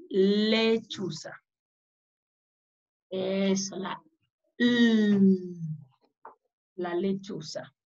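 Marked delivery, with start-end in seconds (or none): 0.1-1.3 "Lechuza" slowly, with "le" drawn out; stretched first syllable. Shown as a demonstration. Teacher asking for the letter, "Lechuza" with long first syllable. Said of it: "¿Qué letra nos presentó ella?". 4.6-5.4 "L" as one long held lll; letter sound. Shown as a demonstration. Teacher confirming the owl's letter, long held "L". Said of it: "¿Qué letra nos presentó ella?".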